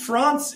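"France" with a broad ah, as "Frahnce"; affected pronunciation. Retold or imitated. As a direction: say "France" with a long open ah. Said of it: "France is just, like, an especially annoying".

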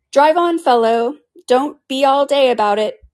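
high, loud, slow and bossy; impatient Griffin. Acted. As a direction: order him on like a coachman. Griffin urging the Mock Turtle.

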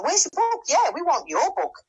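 very high mock chorus of demands; excited, high-pitched retold clamour; crowd clamouring. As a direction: imitate a crowd of eager fans, pitched right up. Imitating a whole bunch clamouring.

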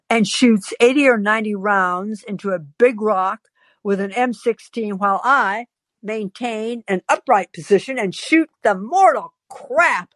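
usual speaking voice

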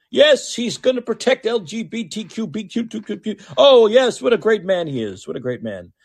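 high, mocking singsong praise; sarcastic praise. Host mocks Biden's promise with high sarcastic gushing. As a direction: gush with heavy sarcasm.

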